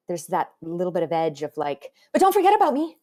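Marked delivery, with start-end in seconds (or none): 2.1-3.0 put-on high, loud, pleading teen voice; needy teen plea. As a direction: cry out not to be forgotten. Voicing Nora's needy edge.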